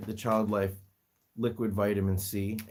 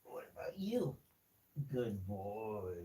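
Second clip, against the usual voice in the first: very soft, almost whispered; hushed coaxing; soft pet-talk; 12 dB quieter, slower than usual. Real, not acted. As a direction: murmur gently to a small animal. Murmuring to a pet.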